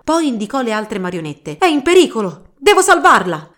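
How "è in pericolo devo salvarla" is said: high, loud and urgent; frantic alarm. Acted. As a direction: cry out as you charge to the rescue. Knight charges to rescue puppet.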